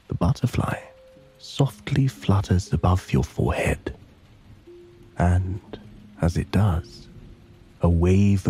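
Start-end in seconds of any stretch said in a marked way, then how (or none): none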